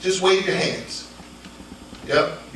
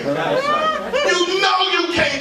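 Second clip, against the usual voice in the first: full-force preaching; 9 semitones higher than usual. Acted.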